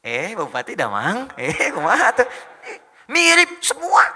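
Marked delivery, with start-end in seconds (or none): 0.0-2.3 drawn-out, singsong Sundanese greeting; playful Sundanese lilt, slow and swooping; local Sundanese accent. Shown as a demonstration. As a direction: imitate a Sundanese local, stretching the vowels. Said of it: "kayak orang lokal lagi".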